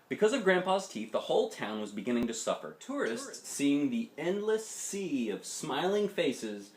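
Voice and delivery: usual speaking voice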